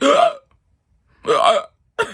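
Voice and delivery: loud high hiccups, imitated. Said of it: "I'm just like".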